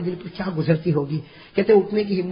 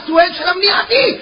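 scolding shout; 11 semitones higher, 7 dB louder than usual. Retold or imitated. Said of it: "کپڑا پکڑا اور کہا".

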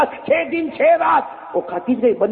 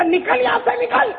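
much higher, sharp angry order; angry dismissal; 10 semitones higher than usual. Acted. Sulaiman angrily orders the greedy fish away, high and sharp. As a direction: throw the fish out in a temper. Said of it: "हजरत सुलेमान ने कहा".

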